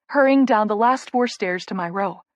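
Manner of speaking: usual speaking voice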